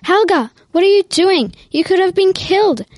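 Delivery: put-on older brother yelling at Helga after the catch. A narrator's reading. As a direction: shout at his reckless sister. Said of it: "Alfa yelled".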